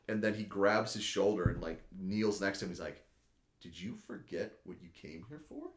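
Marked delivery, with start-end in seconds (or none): 3.6-5.7 soft, menacing acted murmur; quiet goading. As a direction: lean in close and needle him softly. Son kneels by father, goading him.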